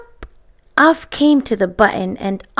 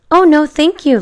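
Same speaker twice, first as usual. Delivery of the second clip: put-on eager girl; 6 semitones higher, 5 dB louder than usual. A narrator's reading. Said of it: "Lisa answered".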